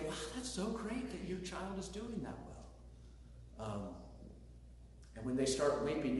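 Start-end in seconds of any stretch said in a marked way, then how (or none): 0.1-3.7 softer, slower, gently gushing praise; sincere delight. Retold or imitated. Pastor modeling kind praise for a friend's child, softly delighted. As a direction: coo your pleasure for another's child. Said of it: "You say".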